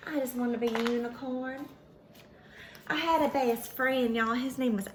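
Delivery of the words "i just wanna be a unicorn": ordinary delivery, nothing marked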